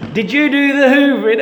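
high, loud questioning wife; astounded wife. Acted. Wife comes in and finds the Hoover out, astounded. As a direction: ask it in high-pitched amazement.

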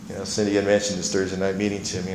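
normal talking voice

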